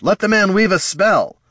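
high, loud urgent order; indignant demand. A narrator's reading. Bard urging friend to allow healing, loud demand. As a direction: demand it forcefully. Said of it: "Heraldon demanded".

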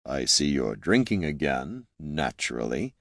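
low, soft and condescending. A narrator's reading. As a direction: look down on her calmly. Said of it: "the serene condescension of an ancient statue".

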